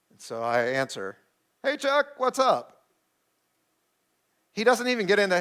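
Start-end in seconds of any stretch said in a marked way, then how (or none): 1.6-2.8 bright high hello on the phone; cheery phone greeting. Retold or imitated. Answering Chuck's call, a cheery high phone greeting. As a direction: pick up the call sounding carefree and much higher.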